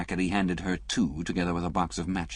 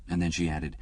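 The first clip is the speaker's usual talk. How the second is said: ordinary delivery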